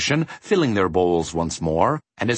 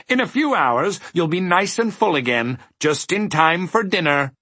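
high-pitched, reassuring character voice; sympathetic dodecahedron; 8 semitones higher than usual. A narrator's reading. Dodecahedron consoling the humbug about dinner, sympathetic. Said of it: "from his most sympathetic face".

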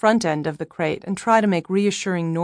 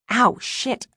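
sudden pain; 6 semitones higher than usual. A narrator's reading. Whacked in the eye.

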